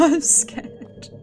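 normal talking voice

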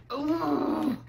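exasperated "ugh"; frustrated groan. Real, not acted. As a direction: let out a fed-up ugh. Fed up with the game.

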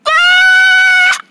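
very high, held shriek; sustained high scream; long scream. Acted. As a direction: scream in alarm. Scream after being chased off.

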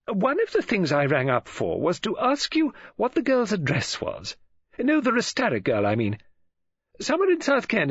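high, gushing social chatter with big swoops; Mrs Oliver, chatty on telephone. Acted. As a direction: gossip brightly into the receiver, pausing for replies. Launched herself into speech.

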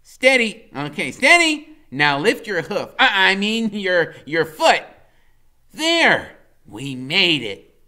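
high, loud, steadying a friend; a boy guide leading his steed across; Freak's voice. A narrator's reading. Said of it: "Freak says".

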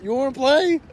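mock challenge. Pretend guy taunting in a joke scene, loud. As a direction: throw it out as a dare.

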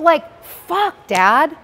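high, loud, tearful plea to dad; upset outburst. Real, not acted. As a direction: beg dad to stop, near tears. Scolding her dad, heard crying.

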